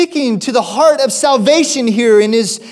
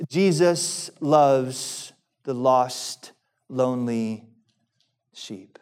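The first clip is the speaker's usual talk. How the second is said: tender hush; 8 semitones lower, 9 dB quieter, slower than usual. Real, not acted. Tender point about lost sheep.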